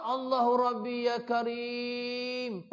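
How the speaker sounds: slow, drawn-out pleading prayer; mock fervent invocation, stretched out; imitating a desperate prayer. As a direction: plead with God while clinging on. Imitating a man clinging to a pole.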